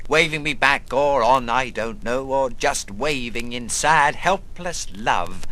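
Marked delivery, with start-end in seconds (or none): none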